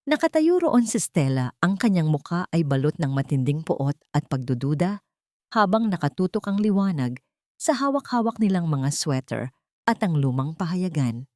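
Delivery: normal talking voice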